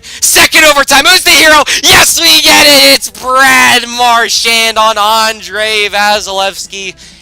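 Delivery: big high shout of joy, slowing on the name; excited cheering. Real, not acted. Gamer cheering his team's overtime winner, excited shout. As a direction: yell it like your team just won. Overtime winning goal celebration.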